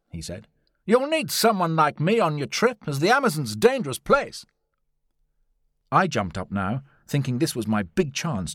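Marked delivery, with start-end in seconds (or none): 0.8-4.4 high, louder confident explorer; Lord John's voice. A narrator's reading. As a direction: offer yourself boldly, pitched up. Narrator voices Lord John Roxton.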